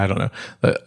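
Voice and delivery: usual speaking voice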